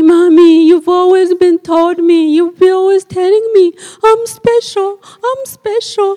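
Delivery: put-on high childish protest; little girl quoting. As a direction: play the small daughter repeating her mother. Daughter voiced in story.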